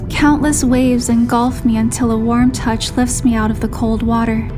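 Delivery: usual speaking voice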